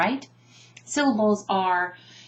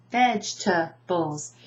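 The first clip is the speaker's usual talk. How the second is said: "Vegetables" slowly as VEJ-tuh-bulls; "Vegetables" in three beats, first one strongest; stress on first syllable; slower than usual. Shown as a demonstration. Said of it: "Where's the emphasis?".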